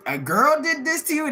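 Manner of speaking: high, incredulous mock question; mocking cops. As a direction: imitate cops amazed and amused. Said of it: "the cops laughed at him".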